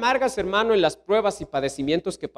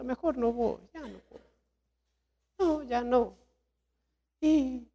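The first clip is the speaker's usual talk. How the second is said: higher, deflated, trailing-off excuses; discouraged believer; 7 semitones higher than usual. Acted. Discouraged husband making excuses to skip church, deflated. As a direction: sigh your way out of going, higher. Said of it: "Tomar una actitud así".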